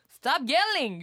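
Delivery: mock fight-stopper. To someone when wanting a fight to end, loud. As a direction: cut the quarrel off loudly. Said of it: "싸움을 멈추고 싶을 때".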